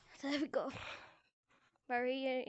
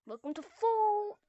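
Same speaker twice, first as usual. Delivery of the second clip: higher, stretched-out catchphrase; announcer flourish; 5 semitones higher than usual. Pretend. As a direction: lift and hold the last syllable.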